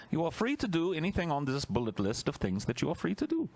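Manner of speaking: high, prim decree voice; mock official voice. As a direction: read it like a smug bureaucrat.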